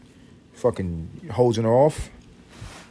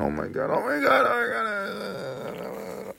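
mock dread; 12 semitones higher, much slower than usual. Dread of germs.

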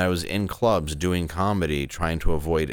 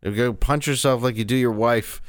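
louder, higher curt dismissal; annoyed; 6 semitones higher, 3 dB louder than usual. Retold or imitated. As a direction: shut him down coldly. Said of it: "I'm like".

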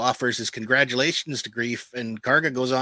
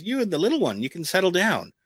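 lightly acted persuasion, pitched higher; a touch of friendly appeal; 6 semitones higher than usual. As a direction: coax him with a higher, lilting offer. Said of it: "appealing to Din's fatherly nature, he says".

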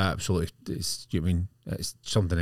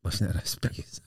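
whisper; 6 dB quieter than usual. Pretend. Echoing with a whisper.

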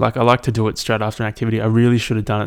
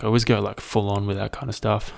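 ordinary delivery

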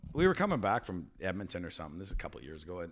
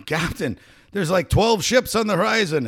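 higher, louder panicked call; yelling down; 9 semitones higher, 11 dB louder than usual. Retold or imitated. Lookout yelling a huge sighting to the captain, loud high yell. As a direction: shout the alarm down from the mast. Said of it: "he yells down".

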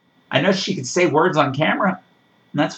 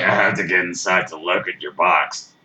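much lower leering voice; low sleazy landlord; creepy superintendent; 10 semitones lower than usual. Retold or imitated. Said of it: "who's like".

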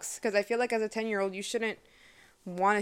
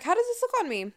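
lightly voiced friend asking, pitched up; hopeful friend asking; 10 semitones higher, faster than usual. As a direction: ask hopefully how it looks, a hint of a friend's voice. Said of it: "they're like".

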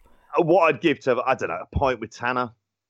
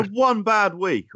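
mock-defensive; 10 semitones higher, slower than usual. Real, not acted. Defensive after being mocked.

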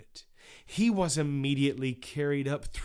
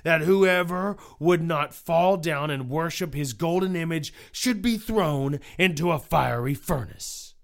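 loud, emphatic courtroom oratory; stern condemning judge; 4 semitones higher, 8 dB louder than usual. Acted. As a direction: pronounce the case against the accused with force. Judge addresses jury in narrated trial.

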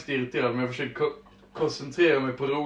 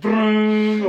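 guitar noise; 8 semitones higher, 6 dB louder than usual. Retold or imitated. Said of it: "trycker skithårt på strängen och bara".

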